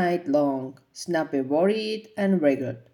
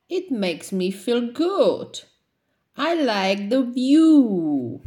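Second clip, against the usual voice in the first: happy mom; 5 semitones higher, 3 dB louder than usual. A narrator's reading. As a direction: savour the view from up the tree.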